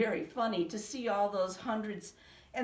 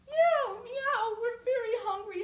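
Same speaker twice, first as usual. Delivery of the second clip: high, loud mewing cats; chorus of thirsty cats; crying cats; 14 semitones higher, 6 dB louder than usual. A narrator's reading. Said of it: "said the hundreds of cats".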